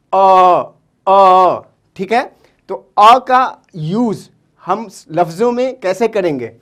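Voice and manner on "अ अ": a louder, held short a vowel; drawn-out a sound. Shown as a demonstration. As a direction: say अ as a clear open short a. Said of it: "इसकी sound जो बनेगी".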